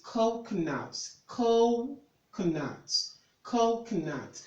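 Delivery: slow "coconuts", first syllable drawn out, COH-co-nuts; stress shown. Shown as a demonstration. Tutor showing where you have the stress, slow stressed pronunciation. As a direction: stretch the CO of "coconuts", then drop. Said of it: "That's where you have the stress there".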